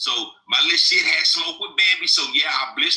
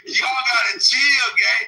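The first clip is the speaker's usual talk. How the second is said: raised, drawn-out call to calm down; loud; 6 semitones higher, 3 dB louder, slower than usual. Real, not acted. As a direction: shout over the room to settle everyone. Calming a rowdy room.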